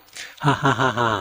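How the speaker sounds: slow, high mock ha-ha-ha laugh, lightly done; a teacher's hearty laugh, played slow and light; imitated laugh. Old monk laughing at the student, a light imitated laugh. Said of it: "ท่านหัวเราะเลย".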